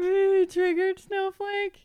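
high, put-on jeering voice; mock taunt. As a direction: imitate a heckler's taunt. Joins in mocking online taunts.

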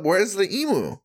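high-pitched, excitable tourist clamor; hammed-up tourist excitement; mock eager tourists. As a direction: demand it like thrill-hungry sightseers. Acting eager tourists demanding emu.

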